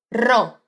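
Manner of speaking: "Ro" with a strongly trilled R; rolled r. Shown as a demonstration. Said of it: "Esse R do início da palavra é bem vibrante". ("Ro" com R vibrante)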